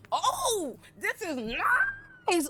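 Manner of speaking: high, slow marvelling voice; impressed bumpkin. Retold or imitated. Ulf at the Dragonstone table, high and marvelling. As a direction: gawk at it like it's a palace. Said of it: "Ulf is in there like".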